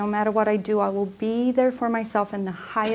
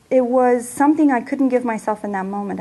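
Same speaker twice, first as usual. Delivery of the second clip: ordinary talk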